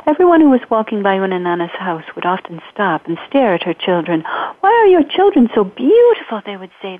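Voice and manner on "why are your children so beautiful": admiring strangers. Acted.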